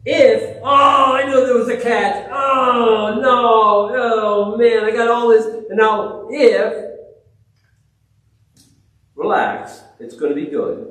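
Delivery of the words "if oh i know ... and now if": high, loud shout of alarm; panicked sinner. Retold or imitated. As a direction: cry out like someone who just spotted the catch.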